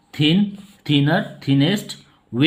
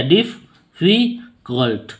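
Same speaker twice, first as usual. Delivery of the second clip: "डिफ, फी, cult" in three slow, separate beats; syllable by syllable; slower than usual. Shown as a demonstration. Teacher counting out three syllables for students, "डिफ, फी, cult" slowly split apart. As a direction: break it into "डिफ", "फी", "cult", pausing between, last one falling. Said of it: "तीन syllable है".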